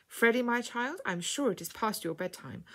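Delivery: ordinary delivery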